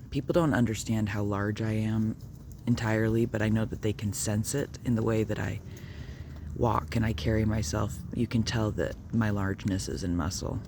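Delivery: ordinary talk, nothing marked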